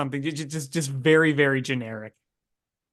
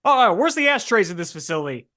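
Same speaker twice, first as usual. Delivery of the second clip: put-on higher, pushy old-movie Transatlantic tough-guy accent; 1950s Transatlantic accent; 4 semitones higher, 5 dB louder, faster than usual. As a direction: play a 1950s Transatlantic-accent spy who insists he isn't one, fast and defensive. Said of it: "He's always got a cigarette in his mouth".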